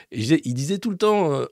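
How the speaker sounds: ordinary delivery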